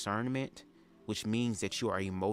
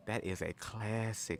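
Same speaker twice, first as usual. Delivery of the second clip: slow, weighty "That is a classic"; drawn-out emphasis; 4 dB quieter, slower than usual. Shown as a demonstration. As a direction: savor "That is a classic" slowly for emphasis.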